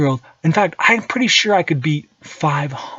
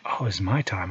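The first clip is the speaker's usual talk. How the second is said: soft unvoiced whisper, much lower; whispered to himself; 10 semitones lower, 11 dB quieter than usual. Alone at five a.m. savouring his time, whispering.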